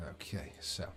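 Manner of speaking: ordinary delivery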